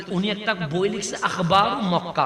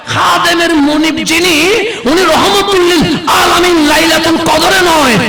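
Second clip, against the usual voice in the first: soar up and hammer it to the crowd; preaching at full force; 10 semitones higher, 14 dB louder than usual. Acted. Preacher rousing the congregation about the Prophet, full-force preaching.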